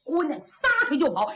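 plain reading voice

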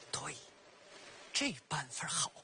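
whispered to herself, plotting; sly narrator's whisper; scheming whisper. Acted. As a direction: hatch the plan under your breath.

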